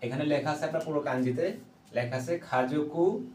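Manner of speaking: ordinary talk, nothing marked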